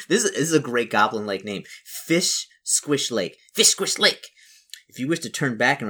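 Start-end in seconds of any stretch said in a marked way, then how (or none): 1.9-3.4 each word drawn out separately; slow, savoured name. Shown as a demonstration. Player relishes a great goblin-like name, slow and savoured. 3.5-4.3 high, loud goblin cry. Acted. Player tries out the lake's name, high goblin voice.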